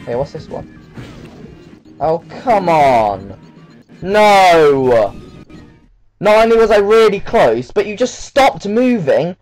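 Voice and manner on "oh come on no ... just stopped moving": loud exasperated high-pitched yelling; panicking gamer. Real, not acted. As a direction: shout at the game in frustration. Character stops moving mid-fight.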